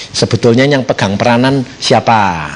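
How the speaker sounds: usual speaking voice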